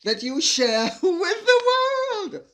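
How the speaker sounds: high, loud, drawn-out reading through laughter; laughing delight. Real, not acted. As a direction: read it out slowly, breaking into a laugh. Laughing while reading fan message.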